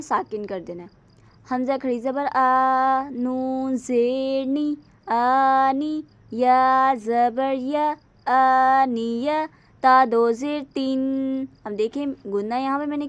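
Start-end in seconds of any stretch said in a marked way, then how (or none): 1.5-11.5 slow, louder spelling out of letters; spelling drill. Shown as a demonstration. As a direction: spell each sound slowly for a child. Drills Qaida spelling aloud. (बच्चों को धीरे हिज्जे कराते हुए)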